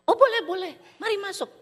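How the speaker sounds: high, bright-voiced eager welcome; cheery welcome. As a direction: welcome him in gladly. Pastor welcoming stranger warmly.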